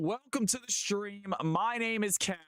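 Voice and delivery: high, slow showman welcome; hype announcer. Acted. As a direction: greet the crowd like a ring announcer. Streamer's hype intro.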